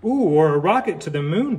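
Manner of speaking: ordinary delivery, nothing marked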